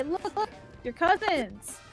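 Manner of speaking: normal talking voice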